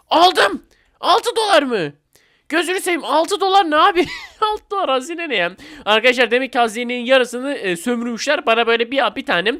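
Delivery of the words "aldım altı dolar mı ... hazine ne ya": excited. Real, not acted. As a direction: burst out thrilled, then deflate at the tiny prize. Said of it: "bir an heyecana kapıldım". (heyecanla, sonra hayal kırıklığıyla)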